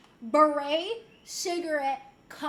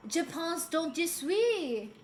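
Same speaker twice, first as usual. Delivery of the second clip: mock French; slower than usual. Quoting Descartes with flair.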